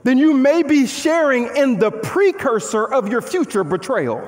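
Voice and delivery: ordinary talk, nothing marked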